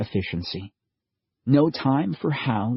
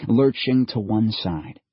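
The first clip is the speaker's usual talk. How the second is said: ordinary delivery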